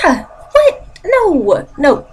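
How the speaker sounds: high-pitched, appalled mock outburst; acted disbelief. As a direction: recoil in exaggerated disbelief. Acts out reaction to bizarre request.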